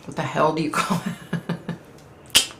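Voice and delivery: ordinary talk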